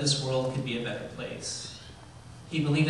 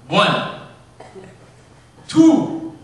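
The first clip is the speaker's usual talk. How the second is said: loud, high warning count, long gap between; dad counting; 12 semitones higher, 15 dB louder than usual. Retold or imitated. Dad counting while his son runs to him, loud calling count. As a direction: call out each number loudly, higher on the second. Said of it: "he's got the count going on".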